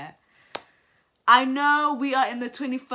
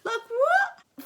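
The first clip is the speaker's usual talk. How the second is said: high-pitched baffled squeak; squeaked, disbelieving question; incredulous; 3 dB louder than usual. Real, not acted. Baffled reaction to the husband.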